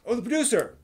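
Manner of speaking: sudden realisation. Real, not acted. Sudden realisation mid-discussion.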